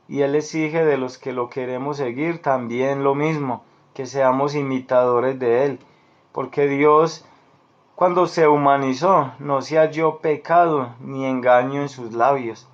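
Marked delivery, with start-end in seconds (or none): none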